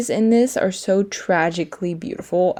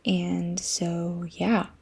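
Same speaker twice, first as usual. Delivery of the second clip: whisper; 9 dB quieter, much slower than usual. Real, not acted. Aside whispered while filming.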